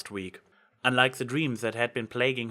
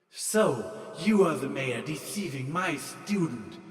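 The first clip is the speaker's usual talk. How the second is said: commanding divine voice, higher and set apart; booming goddess; 3 semitones higher than usual. A narrator's reading. Sun goddess confronting Trixie, booming and commanding. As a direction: pronounce judgement like a wrathful sun goddess. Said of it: "a booming voice commanded".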